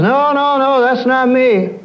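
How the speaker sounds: high, slow, earnest-sounding denial; mock earnest denial. Preacher mimics people disowning their tantrum: high, slow. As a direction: protest it's not you, high and drawn out. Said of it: "We say it in all seriousness".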